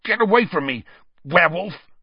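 high, loud, alarmed gasp. A narrator's reading. Injured boy backing off from his teacher, gasping. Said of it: "Ron gasped".

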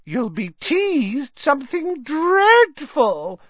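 put-on high, slow, gleeful ghost girl; happy ghost.

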